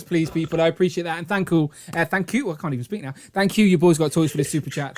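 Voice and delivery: usual speaking voice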